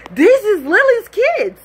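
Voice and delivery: high, loud, drawn-out disbelief; reliving a horrified discovery; shocked realisation. Retold or imitated. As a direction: blurt it slowly in high-pitched alarm. Retold moment of shocked realisation.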